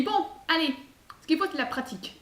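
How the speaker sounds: put-on high, brisk little-girl voice; bossy little girl. Girl urging the monk to practise, high and brisk. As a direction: chivvy him along like a teacher. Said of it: "Et elle lui dit".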